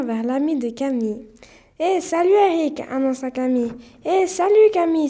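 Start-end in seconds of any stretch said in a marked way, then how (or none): 1.8-2.8 raised, louder hello; bright call of greeting. A narrator's reading. Camille arrives at Eric's and greets him, calling out. 4.0-5.1 high, loud hello back; hearty greeting. A narrator's reading. Eric sees his friend Camille, greeting her heartily.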